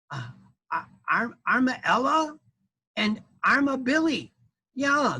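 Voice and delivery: high, happy critter voice; proud little mama; armadillo mama. Acted. Armadillo mama reading her babies' names, high.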